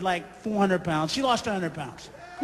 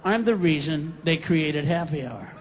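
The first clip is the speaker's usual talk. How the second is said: low, weary barfly; mock husband who wants a drink; lower voice of a drinker; 8 semitones lower, 3 dB quieter than usual. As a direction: grumble it like a tired husband. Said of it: "He has that look like".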